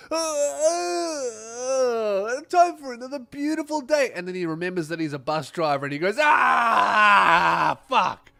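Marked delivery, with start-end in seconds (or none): 0.1-4.1 high, strained mock-cheery groan; groggy bus driver. Bus driver waking up to another day, mock-cheery groan. 6.2-8.3 loud, disgusted groan of a curse; fed-up curse. Acted. Bus driver remembering his job, loud disgusted curse.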